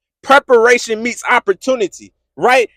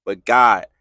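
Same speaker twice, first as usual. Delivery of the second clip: low-pitched burst of objection; pleading protest; 12 semitones lower than usual. Acted. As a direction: break in on the hushed reply to object. Believer protesting to God.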